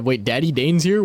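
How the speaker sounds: ordinary delivery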